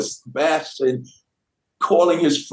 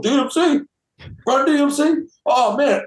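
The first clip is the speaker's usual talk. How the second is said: high-pitched, imitated fan astonishment; amazed recognition; 8 semitones higher than usual. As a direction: act out a starstruck double take, pitched up. Acting Mick's amazed reaction on phone.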